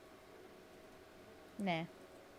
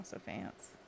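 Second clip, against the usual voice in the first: soft, breathless whisper; 12 semitones lower, 9 dB quieter than usual. Real, not acted. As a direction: whisper it in awe. Whispered remark.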